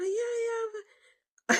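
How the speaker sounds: high, wobbly voice holding back tears; retold tearful reply; tearful yeah. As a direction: choke out a brave yeah while crying. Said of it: "and I started crying".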